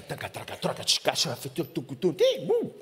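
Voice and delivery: murmullo de gente imitado con sílabas sueltas; ruido de multitud imitado. Retold or imitated. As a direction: imitar el bullicio del camino. (imitando el ruido de la gente)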